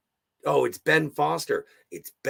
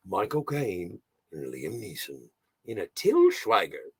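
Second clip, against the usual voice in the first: usual speaking voice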